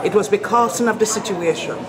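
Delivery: light Jamaican accent, "because" as /pɪkɑs/; a hint of standard Jamaican speech. Shown as a demonstration. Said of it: "Doing Jamaican here".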